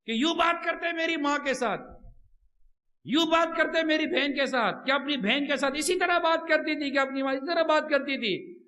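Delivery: ordinary talk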